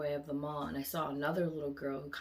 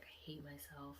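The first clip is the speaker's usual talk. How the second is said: a hushed unvoiced whisper, barely audible; whispered self-hate; 2 semitones lower, 17 dB quieter than usual. Girl upset at her own reflection, whispering. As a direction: whisper it as if ashamed to say it aloud.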